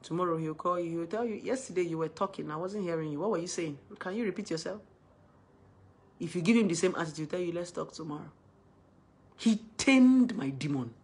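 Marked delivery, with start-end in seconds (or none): 1.3-4.8 softly spoken, calm questions; talking without screaming. Retold or imitated. Boyfriend calls the day after her shouting, talking without screaming. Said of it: "taught me how to talk without screaming". 7.5-8.3 soft, calm put-off; talking without screaming. Retold or imitated. Boyfriend faced with the same attitude, talking without screaming. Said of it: "taught me how to talk without screaming".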